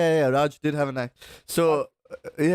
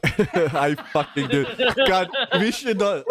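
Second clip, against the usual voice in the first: laughing; 6 semitones higher, slower than usual. Real, not acted. Laughing at friend's joke.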